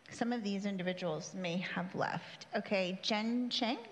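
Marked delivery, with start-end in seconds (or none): none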